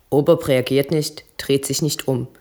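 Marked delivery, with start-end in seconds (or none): none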